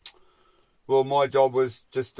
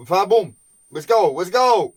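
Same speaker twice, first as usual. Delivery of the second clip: louder, pushy call to work; Louis rallying the resting crew; imitating Louis urging; 3 semitones higher, 6 dB louder than usual. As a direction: hurry the crew along, mock boss. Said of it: "Louis would be like".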